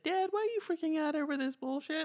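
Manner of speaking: put-on high-pitched little kid voice; young son. Son walking by his weeping dad, high kid voice. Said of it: "his son walked by and said".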